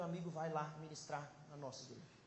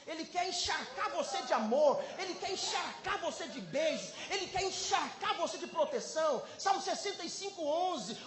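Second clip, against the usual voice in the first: high, loud, driving sermon delivery; full-force preaching; 9 semitones higher, 8 dB louder than usual. Real, not acted. Preacher assuring the congregation God delights in them, full-force preaching. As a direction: preach it with mounting fervour.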